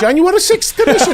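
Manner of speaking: very high Irish lilt, laughing; Irish accent. Shown as a demonstration. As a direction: go way up at the end, Irish. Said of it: "So anything you say".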